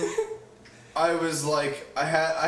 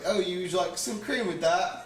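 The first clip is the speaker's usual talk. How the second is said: higher-pitched mock British accent, rising at the end; 5 semitones higher than usual. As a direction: play along in a joking British accent. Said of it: "have to keep this British accent going".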